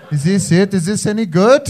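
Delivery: loud, eager mock Welsh accent; bad Welsh accent impression. Impressionist asks the Welsh person for approval, bad Welsh accent. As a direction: show off a dodgy Welsh accent, rising hopefully. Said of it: "if someone tries to do your accent".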